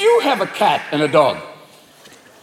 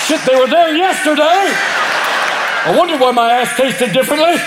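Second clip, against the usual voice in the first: distressed dog; 6 semitones higher than usual. Acted. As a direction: wail in sudden dismay like the dog. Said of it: "cat mind fucks your dog".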